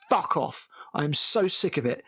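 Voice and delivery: normal talking voice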